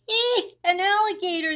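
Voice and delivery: high, loud squealing panic; frightened wail. A narrator's reading. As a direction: shriek it like you've seen a monster. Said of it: "they wailed".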